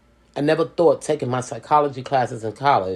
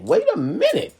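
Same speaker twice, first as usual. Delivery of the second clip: "Wait" jumps much higher and louder with a rising end; rising exclamation; 6 dB louder than usual. Retold or imitated. Telling the couple to hold on, loud rising exclamation. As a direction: hit "Wait" high and loud, as if stopping everything. Repeats the line, rising to an outburst.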